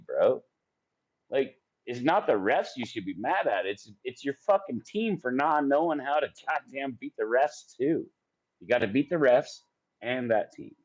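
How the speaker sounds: normal talking voice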